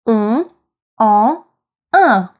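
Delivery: "un, en, un" as three separate nasal vowels; French nasal vowels. Shown as a demonstration. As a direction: let "un, en, un" ring through the nose without closing on n. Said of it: "sounds that come from our nose".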